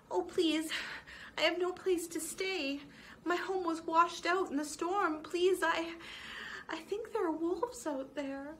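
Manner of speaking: high, fearful begging voice; a small mouse begging not to be sent into the storm; pleading frightened mouse. Acted. Homeless mouse Mona pleading with the badger for a room, high and afraid.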